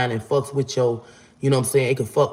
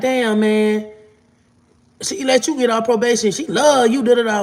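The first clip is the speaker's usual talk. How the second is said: high-pitched mock complaint; mad, imitated; 9 semitones higher, 3 dB louder than usual. Friend griping about early probation release, mad. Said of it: "Fee was mad".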